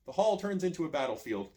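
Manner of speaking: usual speaking voice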